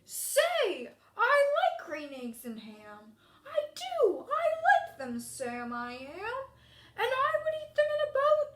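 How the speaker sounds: lively, hammed-up storybook character voices; character voice reading. Shown as a demonstration. Teacher reading Green Eggs aloud to the class, in lively character voices. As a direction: bring Sam's friend to life for the class.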